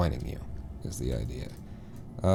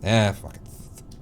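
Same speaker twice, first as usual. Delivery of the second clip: ordinary talk, nothing marked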